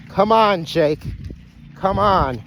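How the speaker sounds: loud, high repeated summons; calling out. Real, not acted. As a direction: holler for Jake to come. Calling his runaway Jake back.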